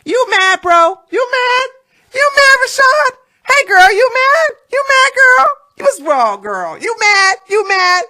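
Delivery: sing-song diva taunt, high and loud; mocking taunt. Host taunting the debater, high squealing mockery.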